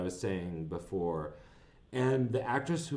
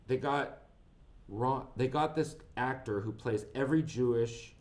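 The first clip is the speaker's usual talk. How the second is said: ordinary talk, nothing marked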